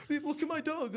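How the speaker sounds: high-pitched, excitable mock voice; hammed-up eager squeal; Mitch showing off. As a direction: imitate an overkeen mate grabbing attention. Acts out Mitch pestering women.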